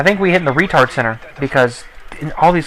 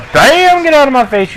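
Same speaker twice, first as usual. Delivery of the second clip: high, louder gloating bam; triumphant shout; 11 semitones higher, 4 dB louder than usual. Real, not acted. Taunting the riot shield player, high triumphant shout. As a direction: slam the kill out with a shout.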